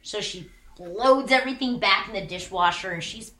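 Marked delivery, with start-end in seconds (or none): none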